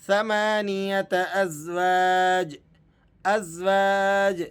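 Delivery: ازواج ending on a small bouncing ج; light qalqalah. Shown as a demonstration. Teacher showing the small qalqalah on ازواج, slow recitation. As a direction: stop on ازواج and let the ج bounce slightly, slowly. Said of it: "آپ نے اسے کس طرح سے پڑھنا ہے؟".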